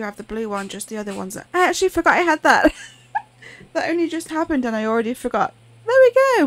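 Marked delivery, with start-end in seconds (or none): 5.9-6.5 higher, louder triumphant cheer; bright burst of glee; excited. Real, not acted. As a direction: celebrate the solved puzzle. Puzzle solved excitement.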